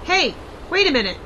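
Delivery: quick, startled realization; sudden surprise. Acted. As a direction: caught off guard. Spots someone coming in.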